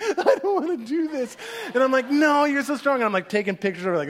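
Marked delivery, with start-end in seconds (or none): 0.0-1.3 high-pitched imitation of a frightened child; mock shaking fear. Daughter waiting for the scary ride, comically afraid. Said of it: "she's, like, shaking". 2.1-3.0 loud, high retold pep talk; urgent encouragement. Dad to his shaking daughter, insistent encouragement.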